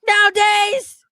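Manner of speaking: high shouted read, hammed up; over-the-top shouting of a comment; mock shout. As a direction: yell it at the listener. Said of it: "screamed at me".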